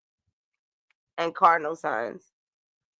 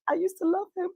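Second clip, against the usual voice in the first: higher and softer, hammed-up lovesick sigh; mock heartbroken; 10 semitones higher, 7 dB quieter, faster than usual. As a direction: fake heartbreak for laughs. Mock-dramatic tearful line to doctor.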